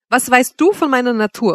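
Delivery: vehement interruption. A narrator's reading. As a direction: cut in sharply and indignantly. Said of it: "unterbrach sie mich heftig". (heftig unterbrechend)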